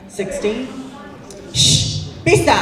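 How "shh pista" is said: loud, high hush and call; sharp hushing shout. Real, not acted. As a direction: snap at someone to hush. Hushing someone mid-speech.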